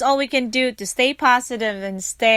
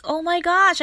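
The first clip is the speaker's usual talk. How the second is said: delighted gasp; 7 semitones higher than usual. Retold or imitated. Excited compliment in store.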